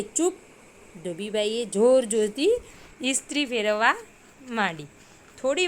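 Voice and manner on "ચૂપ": sharp hush. A narrator's reading. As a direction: shut him up in one sharp word. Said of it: "ધોબીભાઈ કહે". (ઊંચા સ્વરે તીખું ચૂપ)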